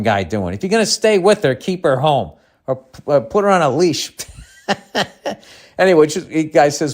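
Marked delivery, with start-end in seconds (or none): 4.7-5.3 short chuckle; brief laugh after a joke. Real, not acted. Host amused by his own leash joke, chuckling.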